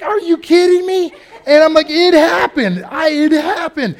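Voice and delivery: excited. Retold or imitated. Excited retold exchange.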